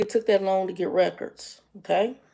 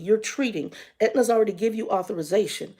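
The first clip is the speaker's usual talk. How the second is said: usual speaking voice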